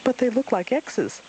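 put-on high childish voice; high puzzled child; little Roo. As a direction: speak as a small eager joey. Roo's child voice.

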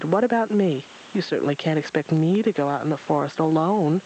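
ordinary delivery, nothing marked

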